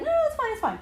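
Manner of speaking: high-pitched mimicked brush-off; breezy dismissal. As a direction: wave it away in a high voice. Imitates husband brushing it off.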